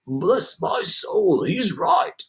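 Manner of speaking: put-on slow, astonished old grasshopper; marvel, slowly; exclaimed. A narrator's reading. Grasshopper agreeing with centipede, slow astonished exclaim. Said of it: "the green grasshopper exclaimed".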